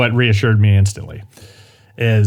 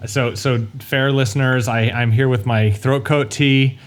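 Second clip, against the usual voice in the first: ordinary talk, nothing marked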